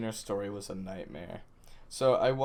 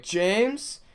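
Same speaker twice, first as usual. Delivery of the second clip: raised, questioning call; 11 semitones higher, 7 dB louder than usual. Retold or imitated. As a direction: call the name up the driveway, high. Calling out to a stranger.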